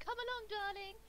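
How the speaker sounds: high, loud, playful coaxing; coaxing darling call. Acted. Player calls her shy companion along, high coaxing voice. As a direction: call a dawdling companion along, lifted and theatrical. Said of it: "And I'm like".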